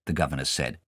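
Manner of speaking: plain reading voice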